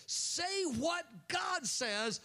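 high, slow, deliberate emphasis on every word; emphatic urging. Acted. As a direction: spell out say what God says slowly. Preacher urging congregation.

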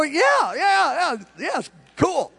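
high, rapid-fire eager yeses; eager agreement. Retold or imitated. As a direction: imitate someone agreeing eagerly. Retold eager answer.

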